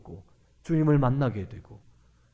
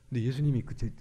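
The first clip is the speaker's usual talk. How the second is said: ordinary delivery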